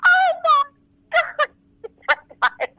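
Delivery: squealed very high and loud; thrilled disbelief. Retold or imitated. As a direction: squeal with joy at being recognised. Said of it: "And I was like".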